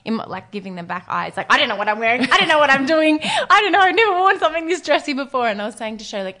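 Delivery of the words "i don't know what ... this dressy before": rising higher and louder, line after line; flustered babble. Retold or imitated. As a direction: spill out panicked confusion. Baffled in the elevator.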